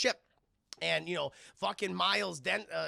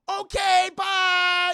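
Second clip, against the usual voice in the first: much higher, loud shouted goodbye; mock cheery shout; 13 semitones higher, 13 dB louder, much slower than usual. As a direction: yell it off as you blast away. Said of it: "He goes".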